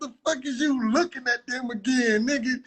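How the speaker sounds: venting at the runner who keeps looking back; yelling at the screen. Real, not acted. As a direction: shout him down in disbelief.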